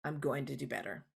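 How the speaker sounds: soft humble apology with quiet resolve; gently promising to do better; sincere apology. Shown as a demonstration.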